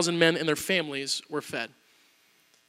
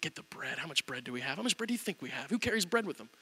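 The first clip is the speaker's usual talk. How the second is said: much softer, grumbling with attitude; 4 semitones lower, 15 dB quieter than usual. Retold or imitated. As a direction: mutter it, annoyed. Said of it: "with a little bit of an attitude".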